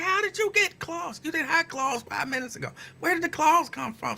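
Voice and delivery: high, incredulous mock voice; mock shocked friend. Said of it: "You be like".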